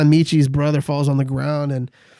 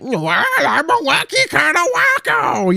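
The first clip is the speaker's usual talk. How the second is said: high, drawn-out goofball voice; over-the-top wacky; 10 semitones higher, slower than usual. Acted. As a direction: ham up a zany mock comedian. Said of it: "do you like his over the top".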